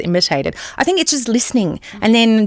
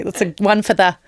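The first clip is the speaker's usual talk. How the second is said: usual speaking voice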